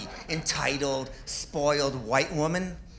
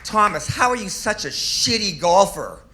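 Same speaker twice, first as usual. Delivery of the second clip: mock scolding; 4 semitones higher, 4 dB louder than usual. Teasing a friend in crowd.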